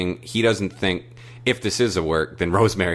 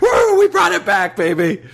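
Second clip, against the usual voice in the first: much higher, louder cheering yelp; excited whoop; 7 dB louder than usual. Real, not acted. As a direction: crow with delight at the callback. Celebrating the callback.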